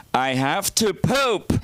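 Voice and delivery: high, slow imitation of mom; put-on mother's singsong; old mother announcing. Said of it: "And they are".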